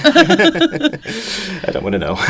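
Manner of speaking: high, slow laughing words. Real, not acted. Laughing about cold sea.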